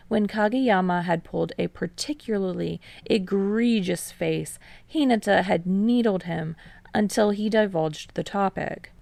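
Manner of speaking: ordinary delivery, nothing marked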